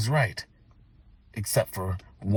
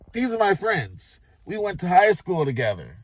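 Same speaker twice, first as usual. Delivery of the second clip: higher, tipsy friendly voice; drunken introduction; 10 semitones higher than usual. Retold or imitated. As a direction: introduce them with drunk cheer.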